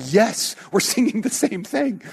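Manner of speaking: high, laughing yes; a laughing traditionalist cheering the same song; laughing mock cheer. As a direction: chuckle through the happy quote. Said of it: "They're going".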